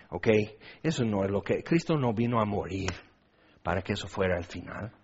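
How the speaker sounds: low, soft, sober admonition; hushed, earnest pastoral warning with pauses; solemn preaching. Real, not acted. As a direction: warn them gravely and quietly.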